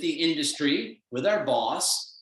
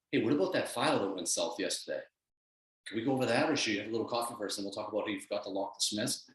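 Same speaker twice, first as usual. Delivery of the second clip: lower, softer nagging voice; the doubting voice creeping in at dawn; muttered inner critic; negative inner voice; 7 semitones lower, 6 dB quieter, faster than usual. Retold or imitated. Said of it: "your negative voice goes".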